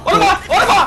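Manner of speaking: high panicked yelling; panicked shout. Real, not acted. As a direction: cry out in sudden panic. Panicked under fire in game.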